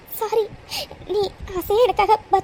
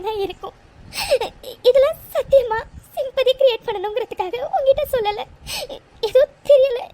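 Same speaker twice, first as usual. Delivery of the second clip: tearful pleading with breaking voice; crying. Acted. Girl tells her friend she never wanted pity, crying. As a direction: cry while insisting it isn't for sympathy. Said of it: "அழாத".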